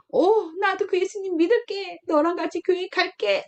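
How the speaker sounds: eager friend. Pretend. Friend gladly accepting the gospel.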